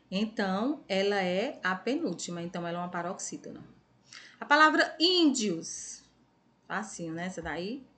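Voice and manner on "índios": índios with a high, long first syllable; stressed first syllable. Shown as a demonstration. Teacher giving pupils the next word, índios, stressed first syllable. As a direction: lift and stretch the ín of índios. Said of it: "pronunciou com mais intensidade".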